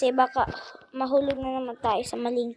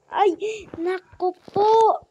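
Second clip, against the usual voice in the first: scandalized; 7 semitones higher, 8 dB louder, slower than usual. Real, not acted. Scandalized reaction.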